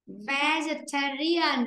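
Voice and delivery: Vegetarian, slowly syllable by syllable, stress on ta; slow and clear so the class can copy it; slow model pronunciation. Shown as a demonstration. Teacher modelling a word for pupils, slow and clear. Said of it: "bạn nghe cô đọc lại này".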